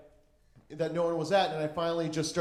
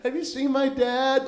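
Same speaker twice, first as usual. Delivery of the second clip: put-on lost kid; 8 semitones higher, 4 dB louder than usual. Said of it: "I felt like a fucking shitty lost kid".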